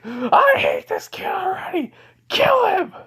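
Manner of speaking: very high, strained screech; pissed-off demon. Acted. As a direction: screech it in a hammed-up rage. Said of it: "because Shinkaku's pissed".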